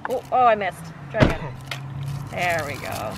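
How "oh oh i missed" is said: usual speaking voice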